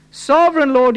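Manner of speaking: desperate prayer. Acted. Preacher voicing the prophet before God's wrath, desperate. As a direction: call out high and full-voiced. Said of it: "the utter desperation of a heart".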